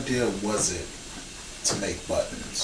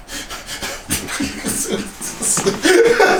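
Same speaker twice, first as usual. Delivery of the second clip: high laugh building louder; real bursting laugh; 7 dB louder than usual. Real, not acted. Host amused that Siri interrupted the interview, bursting laugh. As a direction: crack up, breathy then loud.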